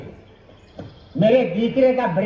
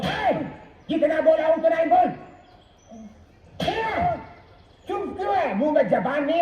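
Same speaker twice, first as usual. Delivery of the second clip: angry scolding father. Acted.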